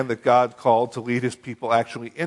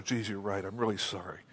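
mock apologetic God; 5 semitones lower, 12 dB quieter than usual. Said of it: "And the Lord said to Joshua".

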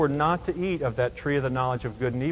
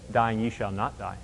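softer devil's twist; 4 semitones lower, 5 dB quieter than usual. Retold or imitated. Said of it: "He said".

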